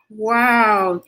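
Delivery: ordinary delivery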